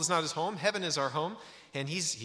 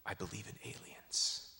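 softer, earnest confession rising at the end; sincere; 2 semitones lower, 8 dB quieter than usual. Retold or imitated. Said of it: "with all sincerity".

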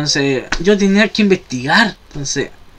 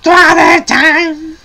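slow, high drawn-out cartoon squeal; Toadette cry; 7 semitones higher, much slower than usual. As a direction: stretch it like a cartoon shriek. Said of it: "hasta grita como la Toadette".

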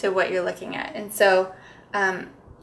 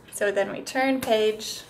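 normal talking voice

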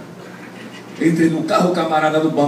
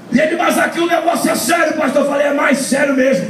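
preaching at full force; 11 semitones higher than usual. Acted. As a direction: shout the story out to the congregation like a revival. Preacher retells the night ride.

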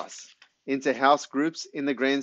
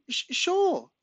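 put-on small boy. A narrator's reading. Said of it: "says Corey".

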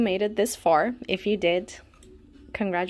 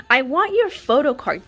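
loud, higher-pitched insistence; exasperated demand; 4 semitones higher, 7 dB louder than usual. Pretend. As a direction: demand it loudly. Mock demand to the missing idol.

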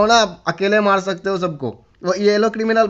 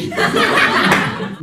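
high breathy laugh. Real, not acted. Laughs at being called bhai.

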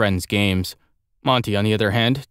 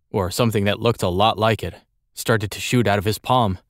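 usual speaking voice

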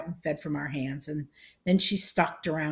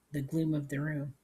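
ordinary delivery, nothing marked

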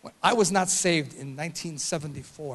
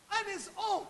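hushed; 13 semitones higher, 10 dB quieter than usual. Shown as a demonstration. Softened repeat after shouts.

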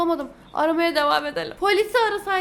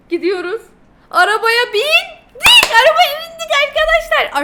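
excited cheer; 11 semitones higher, 12 dB louder than usual. Real, not acted. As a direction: crow the win to the viewers. Triumphant after getting the ride.

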